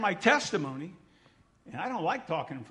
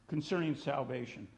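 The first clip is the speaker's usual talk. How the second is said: ordinary talk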